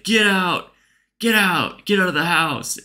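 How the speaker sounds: high-pitched, repeated cry of outrage, imitated; mock angry yelling. Basement guy ordering the family out after stabbing their cat, mock angry yell.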